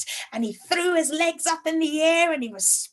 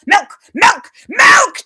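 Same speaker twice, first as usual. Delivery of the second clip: each milk louder and higher; rising disgust; 7 semitones higher, 15 dB louder, much slower than usual. Acted. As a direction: build the milks up in disgust. Old man ranting about milk.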